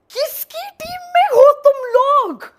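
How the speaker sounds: exasperated outcry. Acted. Yelling at the friend who embarrassed her, loud and high. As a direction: yell at your useless friends.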